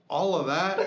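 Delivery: high, loud laughing disbelief; can't believe it, laughing; dad staring at the huge wad of gum, laughing in disbelief; incredulous laugh. Real, not acted.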